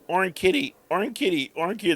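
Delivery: high-pitched imitation of an excited toddler; little girl. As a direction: chant it like a small child spotting a cat. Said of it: "So there was a little girl here".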